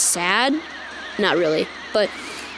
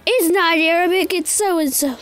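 higher-pitched nitpicking viewer voice; mock corrector; 6 semitones higher than usual. Player imagining viewers correcting him, mock high voice. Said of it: "please don't be like".

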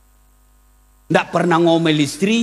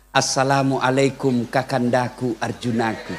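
sweet greeting; 8 semitones lower, 5 dB quieter than usual. Retold or imitated. Wife greeting husband sweetly, acted.

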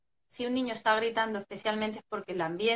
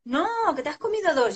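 louder and higher, firm refusal; exasperated parent; 7 semitones higher, 5 dB louder than usual. Retold or imitated. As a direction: shut the child's request down. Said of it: "Y tú estás".

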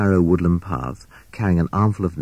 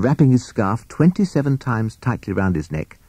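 usual speaking voice

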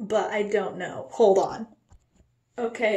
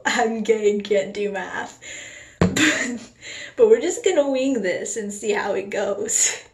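ordinary talk, nothing marked

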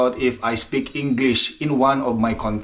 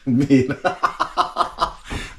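short run of giggles; chuckling. Real, not acted. Vlogger apologizing to maybe annoyed viewers, chuckling. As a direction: laugh off your own apology.

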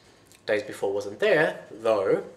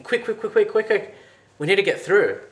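high, louder frantic urging; urgent; 10 semitones higher, 7 dB louder than usual. A narrator's reading. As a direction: rush him on, panicking. Urgent escape, dragons behind.

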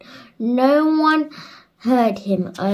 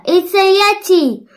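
high, loud warning cry; alarmed cry; 11 semitones higher, 10 dB louder than usual. A narrator's reading. Peter warning the villagers, high alarmed cry.

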